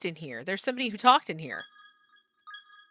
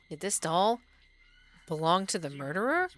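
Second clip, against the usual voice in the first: ordinary talk, nothing marked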